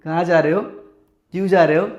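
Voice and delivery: mock interrogation, voice dropped; mimicked wife's questioning. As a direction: mimic her probing questions. Voicing wife's nagging questions.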